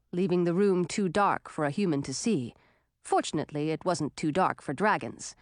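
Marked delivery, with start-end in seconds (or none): none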